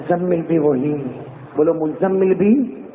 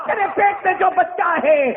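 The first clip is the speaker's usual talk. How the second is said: preaching at full force. Acted. Preacher recounting Amina to the gathering, full-force preaching. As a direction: preach it out at the top of the voice.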